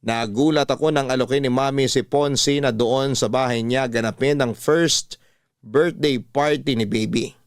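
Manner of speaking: ordinary talk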